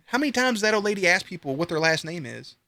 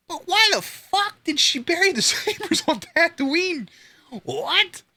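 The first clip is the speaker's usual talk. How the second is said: high, laughing disbelief; cracking up in disbelief at the saber burial; incredulous. Real, not acted. Fan ranting to a friend about Rey burying the sabers, high incredulous disbelief.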